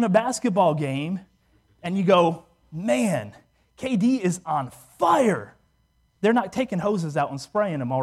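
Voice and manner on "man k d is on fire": slow, drawn-out fan's exclamation; impressed sports fan. Acted. Fan watching a basketball game, impressed exclamation. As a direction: stretch it out in awe at the player.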